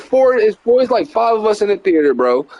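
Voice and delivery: usual speaking voice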